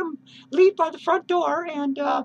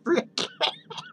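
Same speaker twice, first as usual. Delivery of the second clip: shrill high giggle; 3 dB quieter than usual. Real, not acted. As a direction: giggle high at your own blunder. Laughs after blurting announcement.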